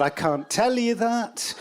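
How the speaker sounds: high, slow robot reply; lightly voiced evasive robot; mild robot impression.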